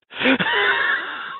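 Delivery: loud, high laugh bursting out; big burst of laughter. Real, not acted. Guest laughing hard at his own story about Bob, loud burst. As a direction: crack up loudly at your own punchline.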